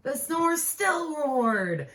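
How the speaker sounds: slow, loud and drawn out; big slow storybook line; dramatic slow refrain. A narrator's reading. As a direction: stretch every word. Refrain stretched.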